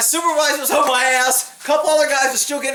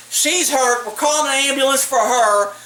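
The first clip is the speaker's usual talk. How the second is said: ordinary talk, nothing marked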